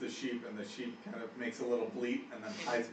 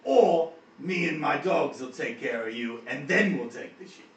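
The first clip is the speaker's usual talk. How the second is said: threatening orc; 5 semitones higher, 10 dB louder than usual. Acted. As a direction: threaten them like a fed-up orc. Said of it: "He says".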